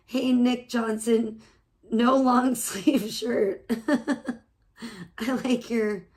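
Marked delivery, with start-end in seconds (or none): none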